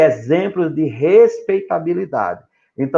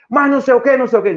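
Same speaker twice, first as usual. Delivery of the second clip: much higher, louder, mocking a cheeky kid; rude child demanding; 9 semitones higher, 4 dB louder, much faster than usual. Pastor mimics a child talking back to his parent, bratty and loud. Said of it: "fala de maneira como falasse um colega".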